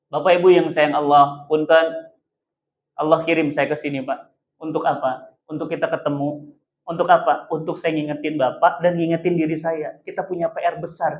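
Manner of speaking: hushed sincere counsel; quiet and sincere. Real, not acted. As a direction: speak gently to the congregation. Preacher turns intimate.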